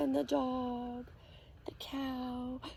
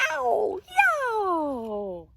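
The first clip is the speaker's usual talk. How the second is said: high yowling cat cry; tomcat yowls; 9 semitones higher, 7 dB louder than usual. Acted. Reading to kids, voicing the tomcat, yowling. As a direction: yowl like a tomcat. Tomcat sound in picture book.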